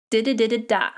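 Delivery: "da-da-da-da-da" as one word, "di-di-di-di-DAH"; four quick light "da" syllables, landing on a strong last "da"; stress on the dah. Shown as a demonstration. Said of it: "Always put your emphasis on das".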